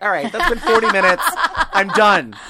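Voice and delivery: loud, laughing, fed up; exasperated. Retold or imitated. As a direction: give up loudly with a laugh. Acted quote, dial-up frustration.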